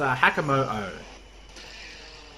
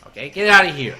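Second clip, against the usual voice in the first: louder, shooing them away; loud dismissive shout; 9 dB louder, faster than usual. Real, not acted. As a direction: yell it at the opponent. Shouting at Gengar.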